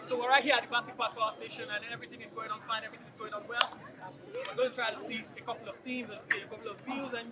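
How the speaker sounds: fast, upbeat on-the-spot report; news reporter voice. Shown as a demonstration. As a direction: rattle it off like a TV journalist. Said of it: "I want to show you guys that I actually know how to cast games".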